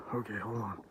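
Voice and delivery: soft real whisper. Real, not acted. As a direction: breathe the words out. Whispering near the deer.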